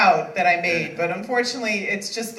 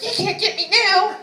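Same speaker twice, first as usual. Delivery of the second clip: put-on childish taunt; 10 semitones higher than usual. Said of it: "I was like".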